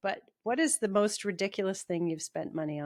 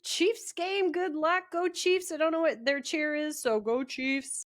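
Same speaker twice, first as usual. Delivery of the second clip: higher-pitched play cheer; lightly hammed-up fan chant; half-hearted mock cheerleader; 6 semitones higher than usual. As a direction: send her off with a put-on high cheer. Mock cheering for co-host's team.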